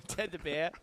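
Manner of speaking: mock Boston accent on "Ted the Bear". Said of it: "keep going with that Boston accent".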